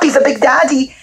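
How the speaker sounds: ordinary delivery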